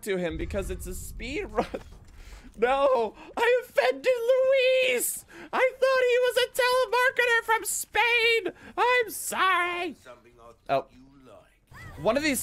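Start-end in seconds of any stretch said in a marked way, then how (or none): 2.6-10.0 overdone weepy apology in a high voice; mock sobbing apology. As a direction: ham up a tearful sorry. Mock apology to game character.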